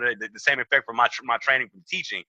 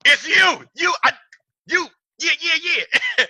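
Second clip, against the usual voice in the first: high, excited fan squeal at a celebrity; starstruck squeal; 14 semitones higher, 4 dB louder, slower than usual. Retold or imitated. Meeting a celebrity, high starstruck excitement. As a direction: gush like you just spotted a star.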